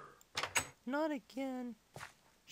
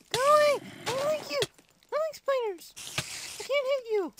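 loud, high-pitched yell; alarmed yelling; 6 semitones higher, 7 dB louder, faster than usual. Real, not acted. Player shouting at spiders in the game, alarmed yell. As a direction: yell it at the attackers.

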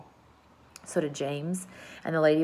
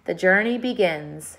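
usual speaking voice

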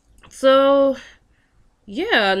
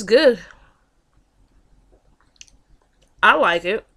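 talking while chewing; 4 dB louder than usual. Real, not acted. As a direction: mouth partly full. Seen 0: holds cupcake throughout, takes bites from 9.6s while speaking.

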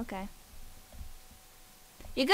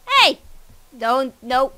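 loud yelp; 9 dB louder, slower than usual. Real, not acted. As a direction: shout it in alarm. Being caught by fishing rod.

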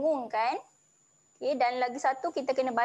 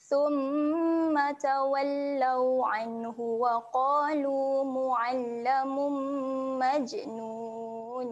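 melodic chanted tilawah; Quran recitation. Acted. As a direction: recite with tajwid. Recites verse fourteen.

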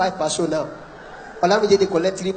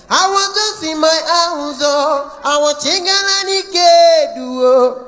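mama's high part; 6 semitones higher than usual. Retold or imitated. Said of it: "Mama Okoro".

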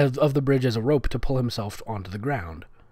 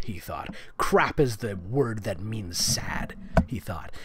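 a slow hushed whisper; 5 semitones lower, 7 dB quieter, slower than usual. Real, not acted. Reader whispering a joke about the story, whispered. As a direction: breathe it out like a secret aside. Whispered aside while reading.

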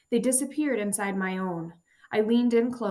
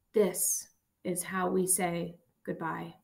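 weak slow farewell; 2 semitones lower, slower than usual. Acted. Dying man comforts friend.

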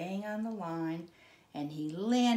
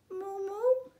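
squeaky mooing bird; a baby bird trying to moo; high little moo; 14 semitones higher, 3 dB louder than usual. Acted.

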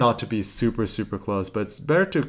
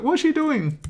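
puzzled quote; slower than usual. Retold or imitated. Voicing someone's puzzled remark.

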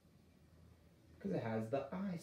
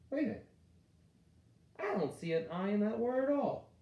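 high, louder, playfully baffled; mock surprise; 9 semitones higher, 3 dB louder than usual. Shown as a demonstration. Teacher pretending to be puzzled by a word, mock surprise. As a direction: act amazed for the class.

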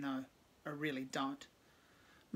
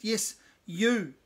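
pointed call; 5 semitones higher, 8 dB louder than usual. A narrator's reading. Cave points at narrator.

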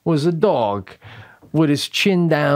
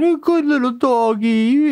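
higher, cooing pet-talk; baby talk to dog; 9 semitones higher than usual. Pretend. Couple on the porch praise the dog, high cooing. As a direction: coo it like praising a puppy.

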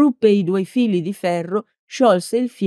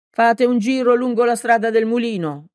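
ordering; 7 semitones higher than usual. A narrator's reading. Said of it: "ordinò Peppone".

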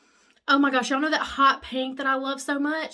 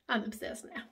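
whispered; 2 semitones lower, 7 dB quieter than usual. Real, not acted. Gushing over makeup.

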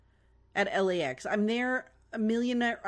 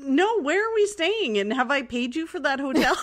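high, loud, incredulous questions; real surprised questioning; 5 semitones higher, 5 dB louder than usual. Real, not acted. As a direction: blurt it out, pitched way up, breaking into laughter at the end. Suddenly learns of unknown hotel booking.

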